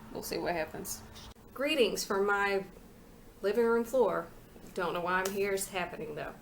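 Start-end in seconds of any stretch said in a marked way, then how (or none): none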